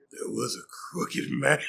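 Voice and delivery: slow hushed eerie rhyme; creepy whisper. Retold or imitated. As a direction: breathe it like a ghost.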